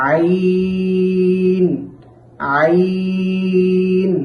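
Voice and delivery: long sung Ain from the throat; Arabic letter demo. Shown as a demonstration. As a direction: chant আইন slowly from the middle of the throat. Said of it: "আইন এমনটা না হয়". (কণ্ঠনালী থেকে টেনে আইন)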